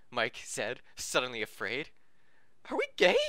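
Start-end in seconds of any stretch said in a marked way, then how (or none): 2.7-3.2 jumps way up, loud and cracking. Acted. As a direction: let the voice crack high. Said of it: "His voice cracked".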